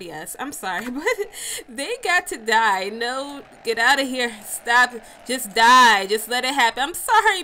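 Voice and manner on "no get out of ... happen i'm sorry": loud desperate yelling; frantic yelling. Real, not acted. Yelling while killing deer.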